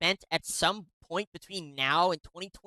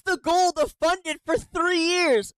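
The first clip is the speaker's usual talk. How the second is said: high, louder disbelieving yell; exasperated outburst; 7 semitones higher, 4 dB louder than usual. Real, not acted. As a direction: blurt it out in outrage. Exasperated reaction to hearing.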